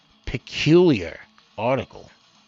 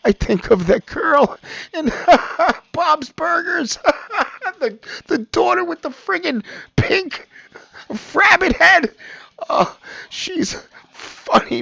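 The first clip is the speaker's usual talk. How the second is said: high-pitched, helpless laughter through words; laughing; 14 semitones higher, 4 dB louder than usual. Real, not acted. Host recalling a Bob's Burgers girl, laughing hard. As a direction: talk while really cracking up.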